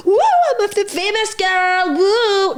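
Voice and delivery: high, slow, squealing mock of a show-off girl; mocking drunk girl. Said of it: "And she was like".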